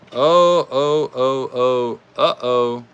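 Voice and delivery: loud rising oh's as the race tightens; excited oh-oh. Real, not acted. Host reacting to a close toy race, loud excited oh's. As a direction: blurt out the suspense.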